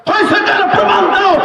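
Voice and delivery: high, ringing sermon delivery; preaching at full force. Acted. Preacher raising his voice to the crowd, fiery sermon. Voicing the Prophet's challenge.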